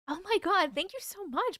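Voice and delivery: normal talking voice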